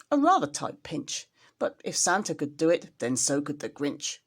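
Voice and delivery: plain reading voice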